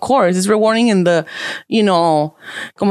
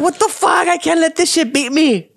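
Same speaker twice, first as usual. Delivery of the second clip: retold anger; 7 semitones higher than usual. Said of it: "I got all fucking mad".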